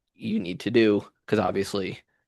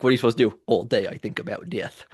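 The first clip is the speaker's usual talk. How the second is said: ordinary talk, nothing marked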